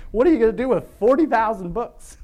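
loud, high-pitched protest through amusement; laughing outburst at an absurd burden; joking exasperation. Real, not acted. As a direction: exclaim it in comic disbelief. Joking exasperation about dad's books.

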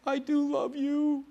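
high, slow mock-crying voice; tearful wife imitation. Preacher mimicking the crying wife's declaration of love, high tearful voice. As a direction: squeak it out slowly as if weeping. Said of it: "I saw her crying about".

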